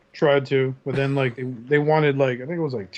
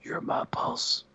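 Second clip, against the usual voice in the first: sappy whisper; 7 dB quieter, slower than usual. Retold or imitated. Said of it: "He goes".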